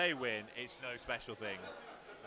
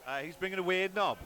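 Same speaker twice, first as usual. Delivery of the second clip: louder Welsh lilt; hammy Welsh voice; Welsh accent; 4 semitones higher, 5 dB louder than usual. Pretend. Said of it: "I'll do the Welsh accent".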